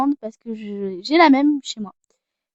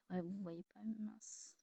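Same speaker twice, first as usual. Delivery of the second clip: whisper; 5 semitones lower, 30 dB quieter than usual. Real, not acted. Whispered to herself.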